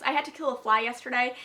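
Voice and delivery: ordinary talk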